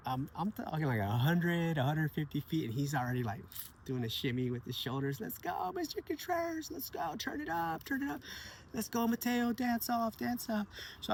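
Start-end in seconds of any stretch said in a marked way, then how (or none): 5.2-10.7 put-on high, hyped-up kid cheering on his teacher; a boy yelling to the teacher in the car to turn up the music, high and excited. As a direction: pitch up high like an eager kid. Kid Sebastian hyping teacher up.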